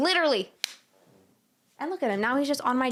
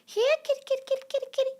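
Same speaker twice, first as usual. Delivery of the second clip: calling the cat; much faster than usual. Retold or imitated. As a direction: call the cat into the night.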